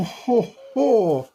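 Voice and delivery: ordinary talk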